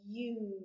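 U drawn out from a y glide into oo; long vowel U. Shown as a demonstration. Teacher shows the changing mouth, U stretched out. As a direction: hold the letter name U long. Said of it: "U is another long vowel sound".

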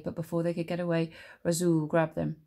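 ordinary delivery, nothing marked

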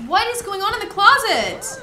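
loud high shout of alarm. Real, not acted. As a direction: yell at the rattling closet doors. Alarmed by noises in closet.

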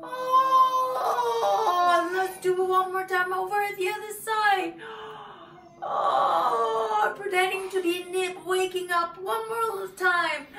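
high, loud, thrilled voice; loud high excited praise; excited wake-up. Pretend. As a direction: burst awake with delight. Pretending to be Nip waking.

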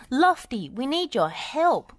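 high, urgent call for help; crying out. A narrator's reading. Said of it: "cried Wendy".

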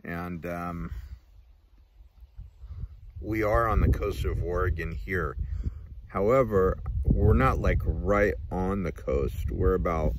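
talking around pipe stem; pipe in mouth. Real, not acted. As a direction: teeth clenched on pipe. Seen 2: Pipe stem held in mouth throughout; removed by 11.9.

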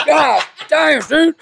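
high, loud mock alarm through laughter; laughing retold shock. Friend reacting to snorting the line, laughing mock shock. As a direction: act the stunned friend while cracking up.

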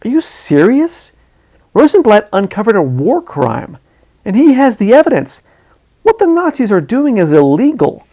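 high, loud disbelieving outburst; strained incredulity. Acted. Reporter incredulous that Wade misses the story. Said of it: "His voice strained with incredulity".

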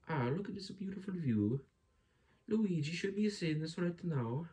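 high, dreamy character voice. Acted. Character admiring the view.

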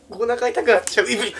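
high burst of laughter; doubled over laughing; laughing hard. Real, not acted. Cracking up.

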